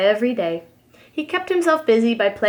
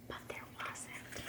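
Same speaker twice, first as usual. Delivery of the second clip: whisper; 27 dB quieter than usual. A narrator's reading. Narrator aside after fake cry.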